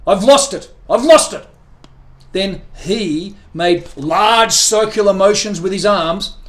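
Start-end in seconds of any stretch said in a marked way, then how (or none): 0.1-1.5 loud frantic yell. A narrator's reading. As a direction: shout it out in despair.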